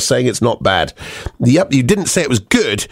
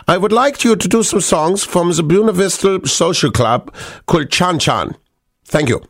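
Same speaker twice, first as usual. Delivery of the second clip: put-on German accent, pitched up; 6 semitones higher than usual. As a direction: read it like a German fan's request. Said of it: "I'm allowed to do the German accent".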